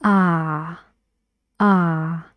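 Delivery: new vowel said carefully; demo of the new sound. Shown as a demonstration. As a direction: open ah for heart. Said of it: "Now about the new sound".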